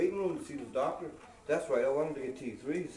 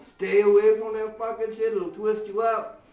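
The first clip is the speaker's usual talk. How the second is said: loud warning; 8 semitones higher, 10 dB louder than usual. Real, not acted. Comic warning the crowd off Haldol, shouting. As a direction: shout it like a real warning to friends.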